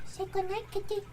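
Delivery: high coaxing pet voice; sleepy talk to pet. Real, not acted. Cat saying goodnight at stream end.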